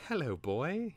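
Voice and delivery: high, swooping greeting to a pet; petting talk. A narrator's reading. Twig to a playful cub, fondly.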